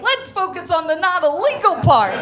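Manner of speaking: high, loud, insistent; mock insistent plea. Traveler steers border agent to the good part, loudly insistent.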